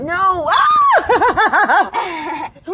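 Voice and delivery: loud, high, laughing scream; laughing shriek. Real, not acted. Goat jumps on mom.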